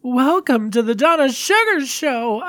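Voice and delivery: high, loud, showy diva voice over music; campy drag queen. Pretend. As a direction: camp it up like a glamorous drag host. Show host persona greeting.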